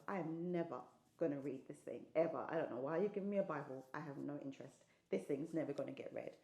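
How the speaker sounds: low, quiet grumble to oneself; dismissive self-talk. Retold or imitated. Niece privately rejecting her auntie's Bible gift, low dismissive undertone. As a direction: retell a private refusal under the breath.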